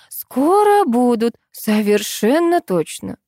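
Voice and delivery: high, slow, cloying phone voice; sugary sweet. A narrator's reading. Administrator soothing a waiting client by phone, sugary sweet. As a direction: gush syrupy reassurance at a customer. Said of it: "появилось сладко-приторное выражение".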